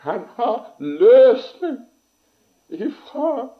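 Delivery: high, loud preaching shout, drawn out; soaring pulpit shout of deliverance; impassioned cry. Real, not acted. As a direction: cry it out at full force, slowly. Preacher's climax on being released.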